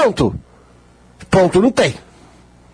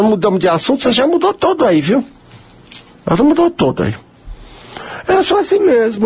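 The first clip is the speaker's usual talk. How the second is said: usual speaking voice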